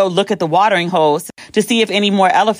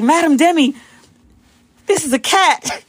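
higher-pitched, polite mock composure; strained professionalism; 9 semitones higher than usual. Guide tells panicked guest the truth, strained professional composure. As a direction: imitate someone correcting politely while holding in laughter. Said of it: "he was trying to keep it professional".

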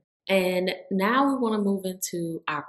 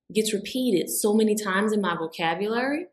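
ordinary talk, nothing marked

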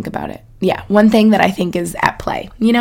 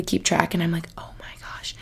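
hushed confiding whisper; 2 semitones lower, 11 dB quieter than usual. Real, not acted. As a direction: lean in and whisper it. Lowers voice to confide.

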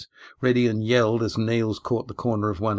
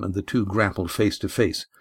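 ordinary delivery, nothing marked

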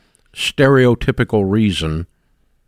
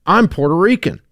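higher-pitched retold protest; insistent audience member; 8 semitones higher than usual. Lady comes up to speaker after the talk, imitated insistence. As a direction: voice a lady earnestly explaining herself. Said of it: "this lady comes up to me and she goes".

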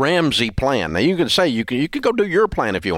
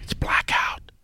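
wry whisper; 5 dB quieter, slower than usual. Real, not acted. Joking aside about blackout dates.

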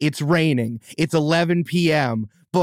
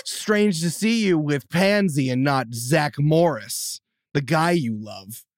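slow, pointed remark; slower than usual. Pretend. Improvised reunion scene.

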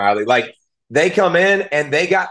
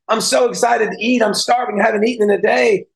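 put-on higher, eager little-girl voice, lightly done; excited little girl; 8 semitones higher than usual. Retelling the girl at the banquet, higher and excited. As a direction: imitate a thrilled hungry kid. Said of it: "she was so excited".

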